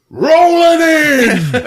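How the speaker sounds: high, loud, drawn-out call; mock whoop stretched long; hammed-up cop call. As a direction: imitate cops charging in. Imitating cops charging in.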